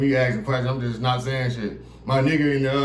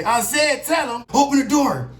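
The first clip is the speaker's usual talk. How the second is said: cop order; 9 semitones higher than usual. Retold or imitated. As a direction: order it sharply. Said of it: "he gonna say".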